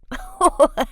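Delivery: loud snorty laugh; snorting laugh. A narrator's reading. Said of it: "let out a snort".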